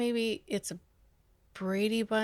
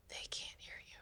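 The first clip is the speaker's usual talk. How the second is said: barely audible whisper; hushed, voiceless aside; 23 dB quieter than usual. Real, not acted. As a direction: drop to a whisper for the punchline. Comic aside on futile shouting.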